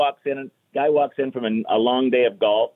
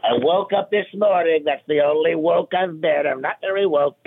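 Trump; 4 semitones higher than usual. Acted. Comic answering a complaint about mean jokes, Trump impression. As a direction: put on a light presidential parody. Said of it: "That's great Trump for you".

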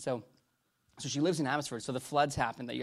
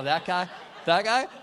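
loud questions, second one pitched higher; mock denial, climbing on the repeat; feigned disbelief; 5 semitones higher, 6 dB louder than usual. Wife disowning the forest guy to a visitor, mock disbelief.

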